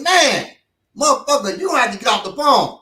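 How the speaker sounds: high, loud bullying order; loud demand. Retold or imitated. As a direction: push him off the phone. Dude demanding phone loudly.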